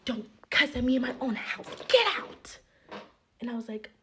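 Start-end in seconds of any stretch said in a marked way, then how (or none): 0.1-2.6 rising into a shouted get out; hammed-up angry friend throwing her out; mock yell. Retelling the friend ordering her out, mock yell. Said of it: "she's just like".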